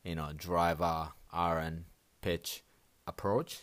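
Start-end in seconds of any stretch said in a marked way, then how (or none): none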